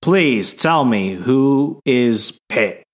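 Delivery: very slow, deliberately spaced-out question; lost, pleading. Real, not acted. Lost co-host asking who Pitt is, slow exaggerated plea. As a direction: draw out each word as you beg for an explanation. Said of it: "I'm actually incredibly lost".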